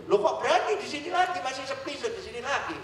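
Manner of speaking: nada naik, heran; tanya heran pada turis; surprised question. Retold or imitated. Asks the Australian, surprised. (bertanya dengan heran, nada tinggi)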